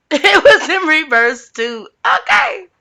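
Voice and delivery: high, laughing exclamation; amused laughing. Real, not acted. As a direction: laugh it out, ending on a high squeal. Laughs at another reversed card.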